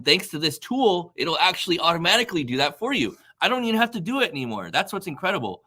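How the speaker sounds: rising pitch, quick, eager rush; excited. Real, not acted. Colleague gushing about automation to live viewers, excited and fast. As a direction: gush about the tool, speeding up. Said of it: "I'm excited".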